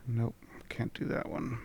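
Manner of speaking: soft unvoiced mutter; whispered aside. Real, not acted. Player quietly ruling out a spell. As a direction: drop it to a whisper. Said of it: "player muttering aside".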